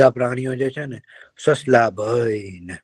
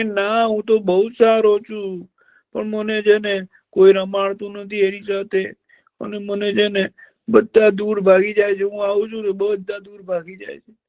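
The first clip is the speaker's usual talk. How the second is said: whining fox; 9 semitones higher than usual. Acted. Fox tells the deer he wants to die, whining. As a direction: moan like a fox faking misery.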